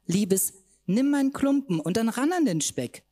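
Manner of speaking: high, loud coaxing call; high loud enticing invitation. Acted. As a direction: lure her in playfully. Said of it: "lockte sie mich".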